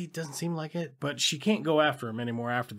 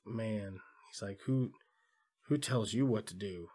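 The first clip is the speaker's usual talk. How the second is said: low, soft and dim-witted; simple-minded voice; 4 semitones lower, 7 dB quieter than usual. Retold or imitated. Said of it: "he's real simple-minded".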